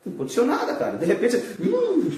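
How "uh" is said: sudden high "uh" shudder; put-on shivery hoot; mock shiver. Shown as a demonstration. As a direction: act a chill striking out of nowhere. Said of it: "dá aquele calafrio".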